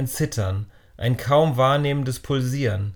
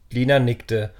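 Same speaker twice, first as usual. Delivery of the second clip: usual speaking voice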